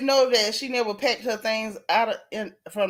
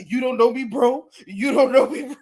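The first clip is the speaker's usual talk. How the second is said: mock tough guy; 3 dB louder than usual.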